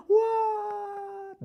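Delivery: very high, stretched-out shocked what; shrieking mock what. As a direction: cry it out in hammed-up outrage.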